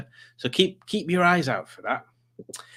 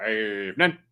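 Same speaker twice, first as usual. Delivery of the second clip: mimicking Dave; 4 semitones lower than usual. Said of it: "And Dave Smith says".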